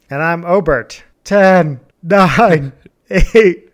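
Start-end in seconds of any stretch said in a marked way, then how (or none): 1.2-3.7 loud excited countdown; countdown shout. Real, not acted. New year countdown shouting.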